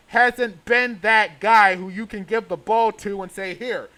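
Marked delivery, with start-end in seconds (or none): none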